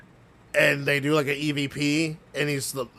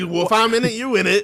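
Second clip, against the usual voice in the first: higher, louder mock shrug; cheeky comeback; imitating the ghost hunter; 8 semitones higher, 6 dB louder, faster than usual. Retelling the ghost hunter's cheeky reply, higher and louder. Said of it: "And he's like".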